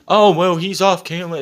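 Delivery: dismissive put-on quote; mocking excuse. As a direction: imitate a careless excuse. Mocks excuse for dropping accent.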